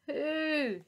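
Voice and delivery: high, loud trumpeting cry; loud mammoth hoo; mammoth hoot. Acted. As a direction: call out like a mammoth in the storm. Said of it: "she cried".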